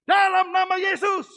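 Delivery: loud, high, slow proclamation; commanding shout. Retold or imitated. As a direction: shout it like a command over the sick. Laying hands, commanding prayer.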